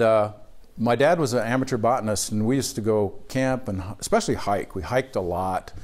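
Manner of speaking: ordinary delivery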